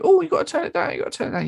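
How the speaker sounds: shrill high mock nag; mock venue staff nagging about volume. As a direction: whine it like a fussy venue manager. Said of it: "we do the sound check venue".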